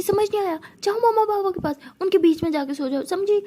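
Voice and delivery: a sharp shouted order to go sleep beside mom and dad; a bit of a shout. Acted. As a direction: yell the order at her friend. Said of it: "थोड़ा चिल्ला के बोलती".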